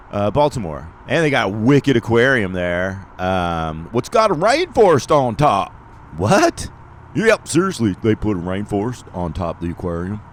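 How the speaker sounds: high, loud, hammed-up Baltimore accent; put-on Baltimore accent. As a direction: play a loud Baltimore local. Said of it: "I don't know why I'm talking like that".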